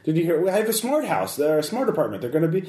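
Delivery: high, jittery NPC voice bluffing about the police; a frightened man trying to sound in control; panicking businessman. Acted.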